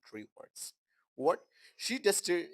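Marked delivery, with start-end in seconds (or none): none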